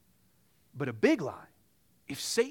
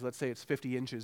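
ordinary delivery

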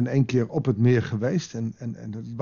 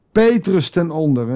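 ordinary talk, nothing marked

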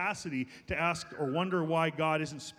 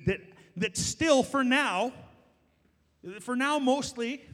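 "for now" lifted and slowed, leaned on; pointed emphasis; 7 semitones higher, 5 dB louder, slower than usual. Real, not acted. Pastor warning church about the nation, pointed slow emphasis. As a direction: hold on "for now" to warn them.

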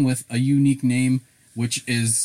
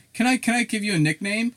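higher-pitched mock nickname request; imitated tentative asker; 11 semitones higher than usual. Acquaintance asking for a nickname, imitated in a higher voice. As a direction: lift the voice, imitating an awkward questioner.

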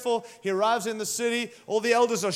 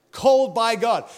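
louder, proclaiming "Called by God"; emphatic proclamation; 7 dB louder than usual. Real, not acted. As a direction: preach it out with force. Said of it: "preacher's emphatic refrain".

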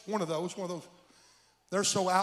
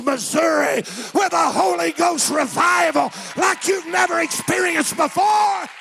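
full-force preaching; 8 dB louder than usual. Retold or imitated. Preacher retelling the man's journey, rising fervent shout. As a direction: drive the testimony upward to a shouted peak.

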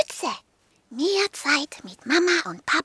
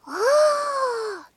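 higher, amazed little dog; 12 semitones higher than usual. Acted. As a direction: gasp it out in wonder. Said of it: "staunte Hundling".

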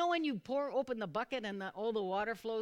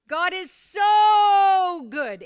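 slow, stretched shout with a long so; much higher and louder, drawing out "so"; say it like you mean it; 9 semitones higher, 17 dB louder, much slower than usual. Real, not acted. Preacher shouts praise to audience.

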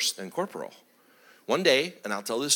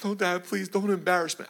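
put-on pleading child; 5 semitones higher than usual. Imitates son begging dad.

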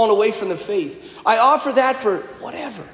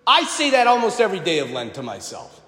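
ordinary talk, nothing marked

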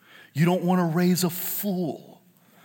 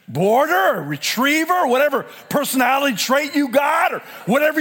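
full-force preaching; 10 semitones higher, 5 dB louder than usual. Acted. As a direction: preach it hard to the congregation. Preacher exhorting parents.

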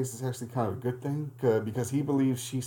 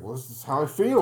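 imitating a worked-up uncle, louder and higher; impassioned retort, raised but not screamed; 4 semitones higher, 7 dB louder than usual. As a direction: push it out loud in mock defensiveness. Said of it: "he's raising his voice".

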